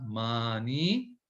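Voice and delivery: each word stretched very slowly; stretched practice drill. Shown as a demonstration. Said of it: "तो आप इसको practice करें दोबारा".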